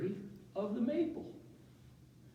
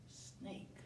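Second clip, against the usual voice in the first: much softer, whispered reading; whispered snake; whispered word; 14 dB quieter than usual. Real, not acted. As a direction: breathe the word to yourself. Reading card quietly.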